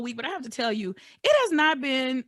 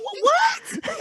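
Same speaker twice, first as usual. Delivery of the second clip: sudden high yelp of surprise, much higher and louder; startled what; 3 dB louder than usual. Retold or imitated. Catching herself sounding like an elder, a high startled shout. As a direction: jolt up into a shocked squeak. Caught herself giving advice.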